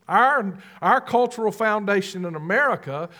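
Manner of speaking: ordinary delivery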